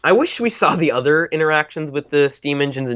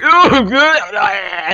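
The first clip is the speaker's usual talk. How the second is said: high loud dopey laugh; dazed laugh; 10 semitones higher, 7 dB louder than usual. Retold or imitated. Mimicking brain dead engine.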